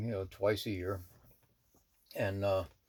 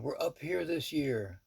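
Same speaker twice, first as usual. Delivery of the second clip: yelling down; 6 semitones higher, slower than usual. Retold or imitated. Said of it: "she's yelling down".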